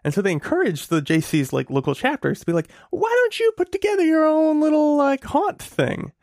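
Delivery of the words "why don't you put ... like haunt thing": high mock suggestion. Voicing organization suggestion.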